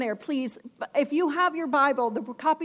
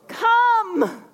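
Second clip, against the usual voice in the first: emphatic come; 7 semitones higher, 7 dB louder than usual. Shown as a demonstration. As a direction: Come called out high and loud. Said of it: "what's the next word?".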